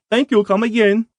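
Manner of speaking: mock Indian shopkeeper accent. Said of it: "I could honestly picture the voice saying".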